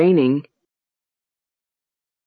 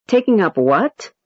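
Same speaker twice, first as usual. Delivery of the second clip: surprised echo question climbing high on "what"; high rise. Shown as a demonstration. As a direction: echo question rising sharply at the end. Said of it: "High rise".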